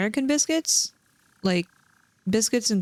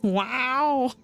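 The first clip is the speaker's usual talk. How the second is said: a higher, drawn-out cat meow on each wow; mock cat meow; 4 semitones higher than usual. Imitating Milo the cat meowing before he's sick. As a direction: mimic a cat yowling. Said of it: "he, like, meows".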